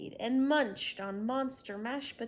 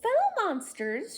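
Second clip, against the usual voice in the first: high, loud call to the crowd; rally the monsters; grand announcement; 13 semitones higher, 10 dB louder than usual. Acted. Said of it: "she announced".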